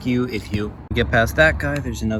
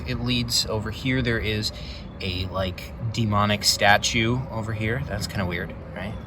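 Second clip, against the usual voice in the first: ordinary delivery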